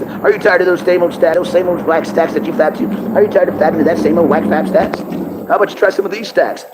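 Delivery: hammed-up commercial read; mock ad pitch. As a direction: sell it like a late-night TV ad. Mock infomercial for porn site.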